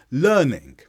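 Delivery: "learning" with LEARN punched, -ing let fall; first-syllable stress. Shown as a demonstration. Teacher showing stressed syllables to learners, first syllable of "learning" stressed. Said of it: "how I stressed the first syllable".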